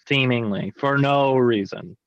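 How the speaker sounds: "Seemingly for no reason" slowly stretched out, leaning on "no"; mocking echo. Real, not acted. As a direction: parrot it back slowly, drawing out "no". Mockingly echoes the other host's claim.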